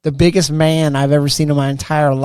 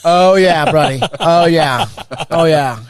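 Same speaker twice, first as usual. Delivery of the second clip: loud, drawn-up mock relish; gloating sergeant; 4 semitones higher, 8 dB louder, slower than usual. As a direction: relish the catch, booming it out. Acted gloating sergeant singles him out.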